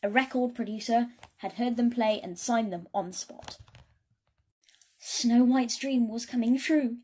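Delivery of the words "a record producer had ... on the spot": ordinary delivery, nothing marked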